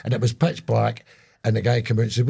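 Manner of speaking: usual speaking voice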